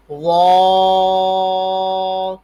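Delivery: وا as a thick, rounded ẓwa held very long; heavy zwa. Shown as a demonstration. Teacher models the fifth heavy letter, long thick وا. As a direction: sustain وا loud and full-mouthed. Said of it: "اس کو بھی موٹا پڑھیں گے".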